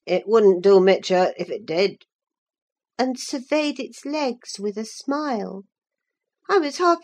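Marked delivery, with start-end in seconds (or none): 0.0-2.0 put-on low rustic voice in a vulgar country accent. A narrator's reading. Young man answers Catherine about her horse, vulgar accent. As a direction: put on a coarse rural accent, pitched down. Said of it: "He answered in his vulgar accent".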